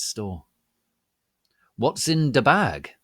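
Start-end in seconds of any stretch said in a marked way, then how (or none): 1.8-2.9 eager question. A narrator's reading. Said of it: "I asked".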